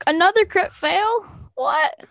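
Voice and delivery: high-pitched, incredulous questions; baffled outburst at rotten luck, pitch leaping up; real disbelief. Real, not acted. Reacts to a bad dice roll.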